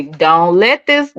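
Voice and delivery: ordinary talk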